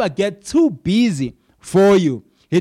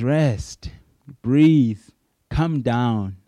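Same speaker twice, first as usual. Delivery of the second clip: calming; 5 semitones lower, much slower than usual. Retold or imitated. Paul calming the listener.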